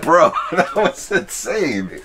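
high, slow laughing disbelief. Real, not acted. Friend laughing in disbelief after the ghostly keyboard, slow and high. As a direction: laugh it out in stunned awe.

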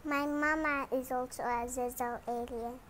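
usual speaking voice

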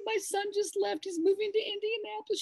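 high, teary voice close to crying; retold tearful words. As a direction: let the voice wobble with tears. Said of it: "And I started to cry".

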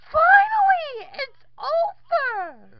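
loud relieved shout; high relieved yell; relieved cry. Acted. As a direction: cheer that it's finally over. Relief after dub ends.

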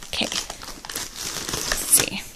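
breathy whispered peek. Real, not acted. Vlogger peeks into the box, whispering. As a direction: whisper it to yourself. Peeking into the package.

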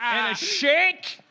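high, loud, stretched Australian; exaggerated Australian accent. Shown as a demonstration. Comic adding to his food order, belted Australian accent. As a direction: draw out the broad Australian vowel. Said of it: "try it with a different phrase".

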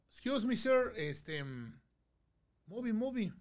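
put-on halting Spanish-accented English; broken English. Groom asking a stranger in broken English. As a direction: fumble for the English words. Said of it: "yo no sé inglés".

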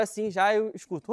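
ordinary talk

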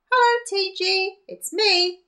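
high, loud, bright little granny voice; cheery old woman. Acted. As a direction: greet him as a spry old lady. Little old woman greets him.

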